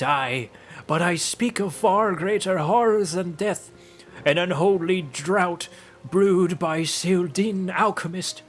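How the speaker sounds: slow, high crone telling dread history; hammed-up doom-laden hag storyteller; ominous old hag. Hag recounting the horrors of war, ominous slow voice.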